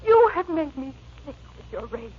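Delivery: high, crying, near hysteria; tearful. Acted. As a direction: sob out the plea.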